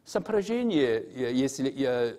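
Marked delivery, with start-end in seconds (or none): 0.1-1.0 сопряжение said the Russian way, pitched higher; сопряжение pronounced as Russian sapryazhenie, raised; Russian word. Shown as a demonstration. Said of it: "if we translate it to".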